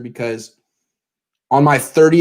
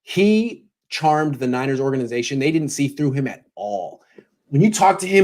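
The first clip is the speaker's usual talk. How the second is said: normal talking voice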